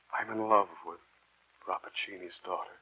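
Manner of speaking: usual speaking voice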